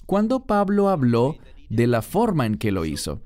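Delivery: normal talking voice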